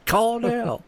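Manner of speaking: high, pushy ad call; infomercial voice. Retold or imitated. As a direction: urge them like a TV ad.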